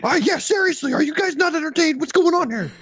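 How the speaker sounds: very high, put-on arena voice; hype crowd taunt. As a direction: goad the crowd like an emcee. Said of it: "And then Joe followed up with".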